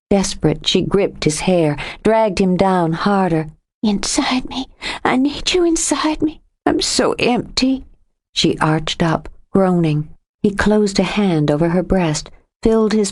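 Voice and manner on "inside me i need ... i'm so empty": high breathy pleading whisper. A narrator's reading. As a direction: beg in a whisper. Aroused whisper.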